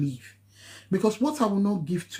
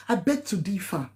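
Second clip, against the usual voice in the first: normal talking voice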